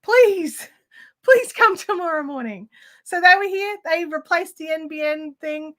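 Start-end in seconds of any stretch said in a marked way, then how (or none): 0.0-2.7 higher, louder, laughing plea; relieved and eager, breaking into a laugh; eager relief. Retold or imitated. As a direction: beg them gladly, almost laughing. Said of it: "I'm like".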